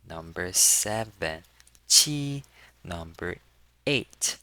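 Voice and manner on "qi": qi high and level; first tone. Shown as a demonstration. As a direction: hold "qi" flat on a high note. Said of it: "with the first tone".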